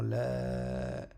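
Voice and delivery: LA stretched out evenly; prolonged vowel. Shown as a demonstration. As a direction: hold on to LA. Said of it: "la prolongation du alif".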